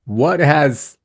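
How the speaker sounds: normal talking voice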